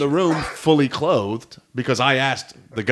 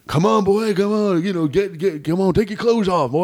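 put-on higher-pitched heckling old man; old guy voice; 7 semitones higher than usual. Old nudist heckling a clothed comic, old guy voice. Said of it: "that's my old guy voice".

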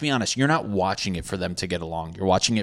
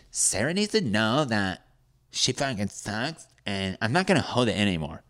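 put-on pot-stirring girl. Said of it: "a girl that likes to stir the pot".

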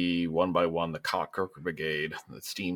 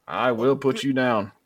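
governor threat; 5 semitones higher, 5 dB louder than usual. Acted. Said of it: "And he goes".

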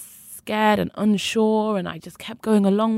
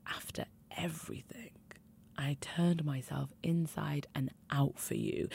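hurt whisper; 3 semitones lower, 14 dB quieter than usual. Retold or imitated. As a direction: breathe it out as if winded by a punch. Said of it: "And I was like".

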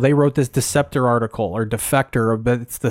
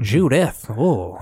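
Bryant Gumbel impression; 2 semitones lower, much slower than usual. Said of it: "this Brian Gumble impression".

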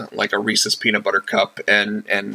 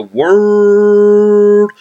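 drawn-out announcer call; 11 semitones higher, 8 dB louder than usual. Acted.

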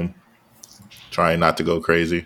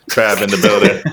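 loud hype-man call; hype intro shout; 4 semitones higher, 5 dB louder than usual. Real, not acted. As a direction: announce it loud. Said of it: "Oh, my bad".

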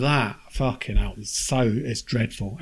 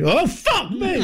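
a much higher, jumped-out-of-skin "fuck me"; startled yelp; 14 semitones higher, slower than usual. Retold or imitated. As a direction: imitate being caught by the jump scare. Jump scare in horror film.

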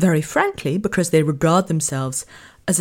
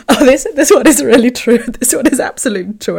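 louder and higher, laughing as she admits it; 6 semitones higher, 7 dB louder than usual. Real, not acted. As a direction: laugh through it, amused. Laughing at a relatable example.

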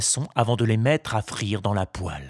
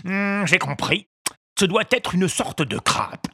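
fisherman voice; 8 semitones higher than usual. A narrator's reading. Fisherman concluding.

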